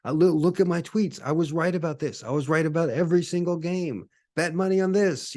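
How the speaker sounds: high, pleading huckster pitch; desperate time traveler. Pretend. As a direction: sell it like a frantic street tipster. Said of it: "He'd be trying to tell people".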